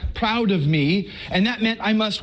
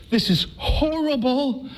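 slow, higher retold dismay; 6 semitones higher, slower than usual. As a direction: drag it out in dread. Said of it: "I was like".

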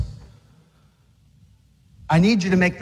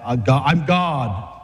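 lower, slow, mock-proud "I'm God"; mock swagger; 7 semitones lower than usual. A preacher joking about how Jesus said it, slow and mock-cocky. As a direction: lightly ham up a cocky claim. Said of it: "How else do you say that?".

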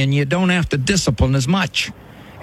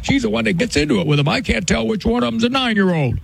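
higher, quicker mock whine of a fed-up husband; complaining husband; 7 semitones higher than usual. Doctor voicing the husband blaming his wife in session, higher mock complaint. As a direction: lightly voice the husband pleading his innocence. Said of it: "why are you taking a back seat".

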